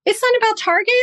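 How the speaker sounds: a lightly retold aha, high on Target; light eureka moment; realizing the real cause, mildly excited; sudden realization. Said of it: "And I was like".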